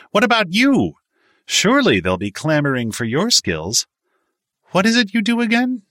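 high-pitched, condescending, loud questioning; haughty challenge. A narrator's reading. Miles turns on Clyde to ask his job, condescending and high. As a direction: needle him with lofty disdain. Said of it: "leveled his icy gaze".

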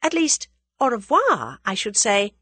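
high, courteous correction; politely. A narrator's reading. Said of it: "she said politely".

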